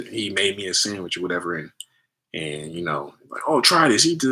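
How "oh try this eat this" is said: higher, louder coaxing dad; mock dad insisting he take a bite; imitating dad offering food. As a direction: push the food on him like a pushy dad.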